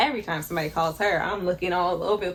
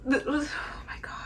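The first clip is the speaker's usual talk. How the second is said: soft, high, breathless awe, last part whispered; hushed excitement; 8 semitones higher, 7 dB quieter than usual. Real, not acted. Reader awed by the book, hushed high excitement. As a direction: gasp it under your breath. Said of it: "I need to curb my enthusiasm".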